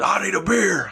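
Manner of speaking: mock Stone Cold growl. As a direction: bark for a beer like a wrestler. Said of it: "And he says".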